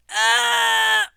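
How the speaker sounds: high, loud startled scream; real jolt of fright; sudden loud yelp of shock. Real, not acted. Jump scare reaction.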